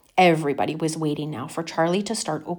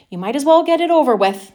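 grumpy grandpa; 8 semitones higher, 8 dB louder than usual. A narrator's reading. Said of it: "Grandpa George said".

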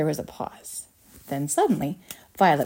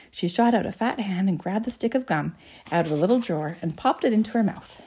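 ordinary delivery

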